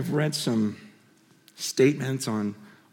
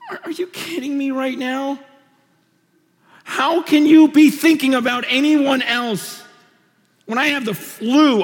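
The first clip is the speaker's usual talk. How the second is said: real tears breaking through a loud, high cry; moved to tears; 9 semitones higher, 5 dB louder than usual. Real, not acted. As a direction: voice cracking as he cries out in disbelief. Moved by her letter.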